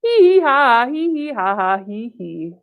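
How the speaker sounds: sing-song "hee hee ha" giggle; put-on storybook titter, tailing off; acted giggle.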